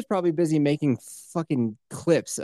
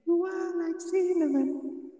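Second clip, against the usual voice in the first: tiny squeaky critter; 7 dB quieter, slower than usual. Acted. High squeaky sung line.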